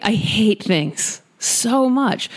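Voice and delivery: "so much" drawn out slowly; emphatic hatred. Real, not acted. Host summing up what they hate, slow emphatic stress on "so much". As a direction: stretch "I hate things so much" for weight.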